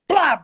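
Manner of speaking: sharp loud slap sound effect; mock slap noise. Retelling the teacher slapping his face. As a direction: smack the sound out. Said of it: "Missus Rigby went".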